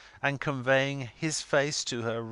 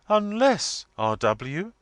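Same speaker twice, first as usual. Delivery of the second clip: solemnly imperious wife, pitched high; solemn address; 9 semitones higher, 7 dB louder, slower than usual. A narrator's reading. Missus Wilfer turns upon R W, solemn and imperious. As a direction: turn on the husband with loud solemnity. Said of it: "being solemnly turned upon".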